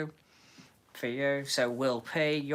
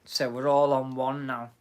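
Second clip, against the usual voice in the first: ordinary talk, nothing marked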